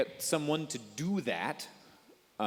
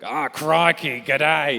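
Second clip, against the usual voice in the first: put-on loud, harsh, over-the-top Australian accent; crocodile hunter; 6 semitones higher, 13 dB louder, much slower than usual. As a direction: go full Australian, much higher and louder. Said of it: "going full crocodile hunter".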